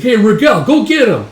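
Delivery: high, loud order; acted battlefield shout; urging call. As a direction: holler to send a comrade in. Orders a man to attack.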